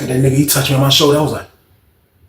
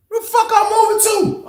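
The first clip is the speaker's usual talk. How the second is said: high shocked shout. Retold or imitated. Surprised at the order.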